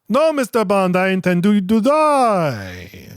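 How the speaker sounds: high, hammed-up menacing villain with vague European accent; Goldfinger villain accent. Villain answering Bond on the laser table, mock menacing accent. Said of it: "villain accent".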